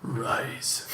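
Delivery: low whispered growl; a failed deep superhero rasp; gravelly Dark Knight attempt. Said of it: "I can't do the Dark Knight thing".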